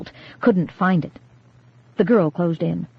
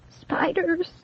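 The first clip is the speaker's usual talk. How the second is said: high, soft trembling word; scared shaky whimper; quivering terror; 11 semitones higher, 6 dB quieter than usual. A narrator's reading. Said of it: "quivering uncontrollably".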